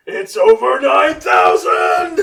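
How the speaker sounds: high, loud, slow shout; loud drawn-out Vegeta bellow. Retold or imitated. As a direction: yell it like the famous Vegeta meme. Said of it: "what's a Vegeta line".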